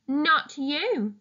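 usual speaking voice

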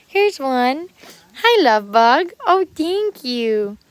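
loud, drawn-out doting on a cat; gushing pet talk. Real, not acted. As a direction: gush at a sweet kitty.

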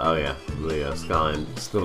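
usual speaking voice

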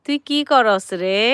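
slow imitation of son's drawn-out Bengali dialect; mimicked Bengali dialect with long-drawn vowels; regional Bengali drawl. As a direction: stretch the regional Bengali words, especially the ending. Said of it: "ওই একটু টান দিয়ে কথা বলে".

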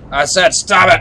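loud, high scolding yell; angry shout. Real, not acted. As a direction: bark it at the monster. Scolding the monster.

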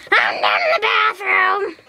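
high, harsh strained shout; put-on Toad voice. Toad answering from the bathroom, harsh put-on shout. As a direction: call out in a rough toy-character squawk.

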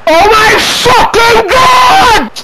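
high, slow, stretched exclamation; shocked yell. Real, not acted. Gamer reacting to a penalty call, shocked yell. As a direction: let it burst out in disbelief.

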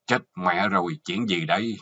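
ordinary delivery, nothing marked

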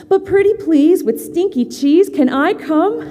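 high, loud pleading; begging little animal. Acted. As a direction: beg sweetly like a child. Animal begs to join crew.

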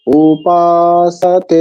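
উপাসাতে drawn out slowly, পা and তে held long; long syllables. Shown as a demonstration. Teacher modelling long vowels, উপাসাতে slow with পা and তে sustained. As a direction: stretch পা and তে in উপাসাতে. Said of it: "পা দীর্ঘ হবে আর তে দীর্ঘ হবে".

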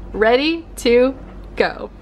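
deliberate, spaced-out "Ready... to... go"; slow emphatic repeat. Pretend. Emphatic repeat of her own phrase.

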